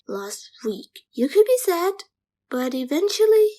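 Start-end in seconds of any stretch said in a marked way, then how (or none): 0.1-1.0 whisper. Acted. Bitter reply to mother.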